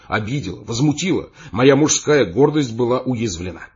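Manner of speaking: ordinary delivery, nothing marked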